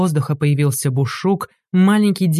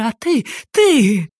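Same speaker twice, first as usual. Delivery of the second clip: breaking into falsetto; 6 semitones higher, slower than usual. A narrator's reading. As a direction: voice cracks high and slow. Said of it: "сбился на фальцет".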